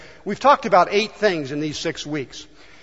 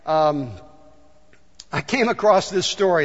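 ordinary talk, nothing marked

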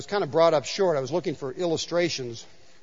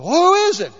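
frantic man; 11 semitones higher, 9 dB louder than usual. Retold or imitated. Man on cliff answers God.

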